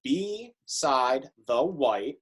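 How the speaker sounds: counting the beats for the class, very slow; counting syllables. Shown as a demonstration. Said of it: "the last stanza in that first line".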